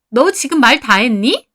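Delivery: loud, challenging question; offended anger. A narrator's reading. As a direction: bristle at the insult. Friend bristles in quarrel.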